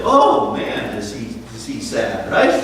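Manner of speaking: ordinary talk, nothing marked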